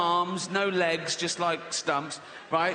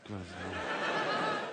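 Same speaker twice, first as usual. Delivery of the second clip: mock laughing dad; 9 semitones lower, 8 dB quieter, much slower than usual.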